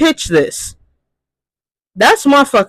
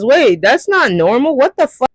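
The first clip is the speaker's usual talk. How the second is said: retold shocked realization; 8 semitones higher than usual. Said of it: "until I realized".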